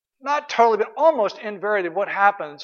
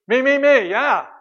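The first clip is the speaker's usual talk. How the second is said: laughing; 5 semitones higher, 7 dB louder than usual. Real, not acted. Joking that the husband only cares about himself.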